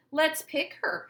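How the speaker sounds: high, loud playground taunt; teasing jeer. Acted. Rita mocking Chrysanthemum on the playground, high and loud. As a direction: taunt her gleefully.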